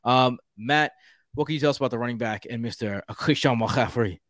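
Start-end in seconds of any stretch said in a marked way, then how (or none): 2.6-4.2 put-on French accent on the name. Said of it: "you added a French accent to it".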